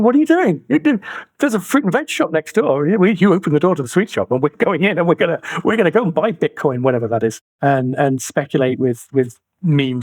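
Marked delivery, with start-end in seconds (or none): 0.0-6.8 higher, mock-exasperated telling-off; exasperated scolding. Adults scolding kids in the sweet shop, in mock exasperation. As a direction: scold the kids like a fed-up parent. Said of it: "And we're saying".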